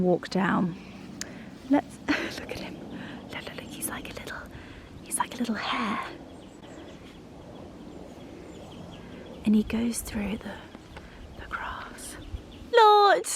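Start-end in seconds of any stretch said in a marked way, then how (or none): 1.7-12.2 quick hushed whisper. Real, not acted. Owner watching her dachshund in the grass, whispering. 12.7-13.3 high, loud exclamation; call out. Real, not acted. Owner calling out to her dachshund, high and loud.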